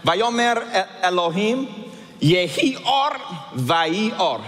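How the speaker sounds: Vayomer Elohim Yehi Or Vayi Or spoken as Hebrew, Yehi Or pitched high; reading Hebrew aloud. Shown as a demonstration. Pastor reading Genesis in Hebrew to the church: careful Hebrew reading. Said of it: "reading from right to left".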